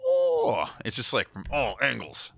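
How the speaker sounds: ordinary talk, nothing marked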